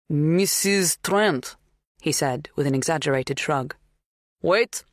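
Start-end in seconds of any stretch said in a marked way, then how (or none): 0.1-1.6 put-on slow, higher Polish-accented guess; strong foreign accent. A narrator's reading. Polish farmhand shrugging out a name, strongly accented. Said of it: "His accent was strong". 4.4-4.8 put-on higher Polish-accented interjection; strong foreign accent. A narrator's reading. Polish farmhand remembering something, strongly accented. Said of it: "His accent was strong".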